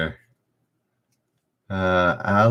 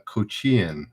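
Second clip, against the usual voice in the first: ordinary talk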